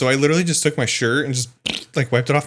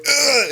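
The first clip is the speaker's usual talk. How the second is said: much higher groan of disgust; retold gag as soap drips in the mouth; high, squealed ugh of revulsion; 14 semitones higher than usual. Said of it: "I'm like".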